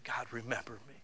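quiet, gentle plea; soft prayer demo. Shown as a demonstration. The pastor models a prayer for the congregation in a soft, pleading voice. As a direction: say it softly, like a prayer. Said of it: "why don't you say".

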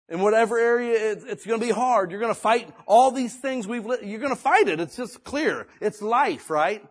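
high, loud, fervent preaching; rising fervour. Real, not acted. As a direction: drive it home to the church. Preaching at full force.

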